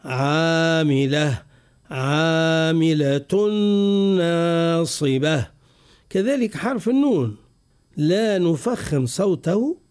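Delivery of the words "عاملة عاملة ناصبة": عالية، عاملة with a light, thin ain, drawn out slowly; slow careful recitation. Shown as a demonstration. Teacher reciting the correct light ع slowly for students. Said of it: "لا، نقول".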